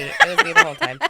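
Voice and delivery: high, loud laughing admission; laughing confession. Real, not acted. Admits delay, laughing.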